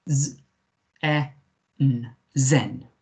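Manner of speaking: "Z, E, N" with long gaps, then "Zen"; sounded out. Shown as a demonstration. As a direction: separate phonics sounds blended into "Zen". Teacher sounding out a German word.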